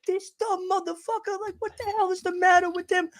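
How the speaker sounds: high, indignant mock rant; angry passenger. Passenger raging at the pilot, high indignant voice. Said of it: "the woman ... that charged the cockpit was like".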